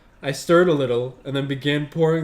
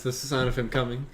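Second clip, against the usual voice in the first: ordinary talk, nothing marked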